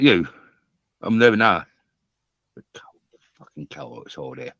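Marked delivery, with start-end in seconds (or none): 3.4-4.5 much softer, under the breath; low swearing aside; muttered curse. Real, not acted. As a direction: grumble it to yourself.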